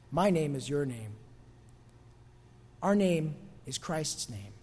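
low, soft and deliberate; sober, hushed. Real, not acted. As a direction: quietly bring the lesson home, with pauses. Preacher drives home the sobering point.

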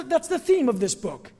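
ordinary talk, nothing marked

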